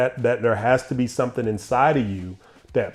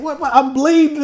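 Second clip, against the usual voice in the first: put-on much higher, louder disbelieving child; shocked kid; 5 dB louder than usual. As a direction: protest like a hurt little boy. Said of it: "I was like".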